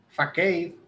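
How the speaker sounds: "facade" said as "fa-KAY"; "facade" as "fakei", final d dropped; variant pronunciation. Shown as a demonstration. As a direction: say "facade" with a k and an "ay" ending. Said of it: "Hay gente que pronuncia".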